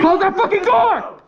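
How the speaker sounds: high, slow frantic yell; panicked shout. Real, not acted. Panicked order in firefight.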